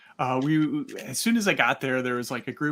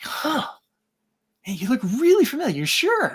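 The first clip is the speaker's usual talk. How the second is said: high puzzled stranger; 9 semitones higher, 4 dB louder than usual. Retold or imitated. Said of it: "he's just like".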